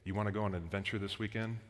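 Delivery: soft, low voiced fatherly invitation; inviting dad. Dad invites his kid on an adventure, soft fatherly voice. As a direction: lightly act a dad, keeping it quiet and low. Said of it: "a dad comes to their kid".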